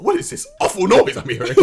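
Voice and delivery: loud, high British outrage; hammed-up British horror; laughing, high-pitched British accent; mock British. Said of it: "I turn British".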